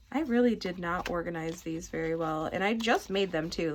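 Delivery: normal talking voice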